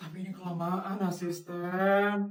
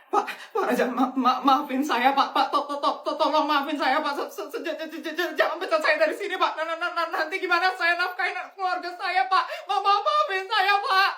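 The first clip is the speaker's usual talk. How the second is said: stuttering begging; 7 semitones higher than usual. Acted. Fired boss begs, stuttering.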